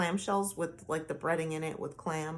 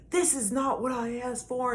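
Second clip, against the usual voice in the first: higher mock complaint from the husband; mimicking angry husband; 6 semitones higher, 4 dB louder than usual. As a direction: imitate his petulant grumble, lightly. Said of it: "And he said".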